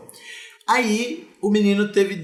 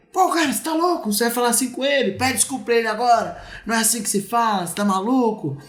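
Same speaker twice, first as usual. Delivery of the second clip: higher, fast, heated telling-off as an imagined dad; angry scolding father; 8 semitones higher, faster than usual. Retold or imitated. Imagined father yelling at his aggressive son, higher, fast scolding.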